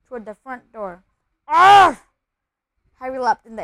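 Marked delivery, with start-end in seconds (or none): 1.5-2.0 loud, high yelp of shock; sudden full-voiced scream; startled yell. A narrator's reading. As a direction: yell out as if stepped on. Harry treads on something alive.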